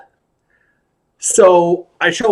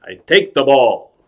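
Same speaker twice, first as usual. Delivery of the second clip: falling, deliberate imitation; Robert De Niro. Actor copies the clown's trick, falling-toned impression. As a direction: bring the patter down to a falling statement. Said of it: "So now he tries it".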